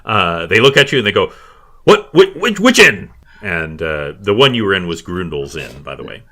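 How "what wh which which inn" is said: high, loud stammered question; flustered guard caught off guard; startled guard. Acted. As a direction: blurt it out in alarm. Guards react to cries for help.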